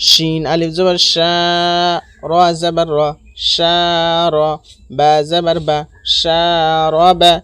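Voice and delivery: শা held long, র বা short; only first syllable long. Shown as a demonstration. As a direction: draw out শা, then brief র বা. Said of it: "শুধু এখানে টান হবে".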